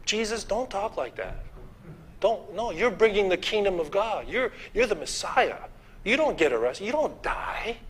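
Peter pleading. Retold or imitated. Peter urging Jesus not to speak of death, pleading softly. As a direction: beg Jesus not to talk of dying. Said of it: "and he said".

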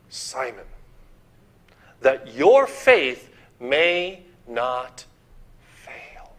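slow and tender, fading to a whispered fail; tender scripture reading. Shown as a demonstration. Preacher reading Jesus' prayer for Simon, slow and tender, ending in a whisper. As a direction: read Jesus' words like a tender promise. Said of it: "Let's go back to that word".

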